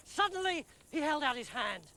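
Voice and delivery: plain reading voice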